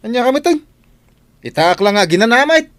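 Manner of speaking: indignant protest. Acted. Caught lazing, protests to coworker.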